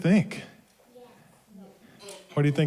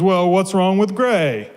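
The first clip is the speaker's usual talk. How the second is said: higher, louder storybook comeback; indignant retort; 5 semitones higher, 5 dB louder than usual. A narrator's reading. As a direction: throw the challenge back as the squirrel. Squirrel retorts to the mouse.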